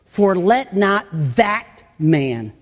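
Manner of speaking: slow reading with weight on "that"; stress on THAT. Shown as a demonstration. Preacher quoting the verse to the congregation, "that" stressed. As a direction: read it slowly, landing firmly on the word "that". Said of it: "a specific emphasis on the word".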